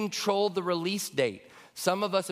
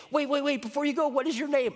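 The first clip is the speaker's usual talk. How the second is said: hammed-up wait-come-back call; healed man calling excitedly after Jesus; eager call after; 11 semitones higher than usual.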